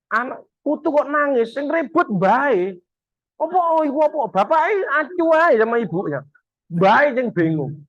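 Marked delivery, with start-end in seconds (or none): 3.4-6.2 high, startled and confused; flustered grandparent. Retold or imitated. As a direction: act lost as the baby cries. Grandparent confused by crying baby. (nada tinggi bingung dan kaget)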